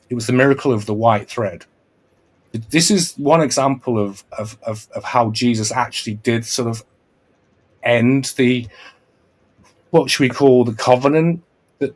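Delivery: usual speaking voice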